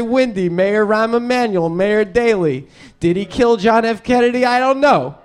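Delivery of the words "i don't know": ordinary talk, nothing marked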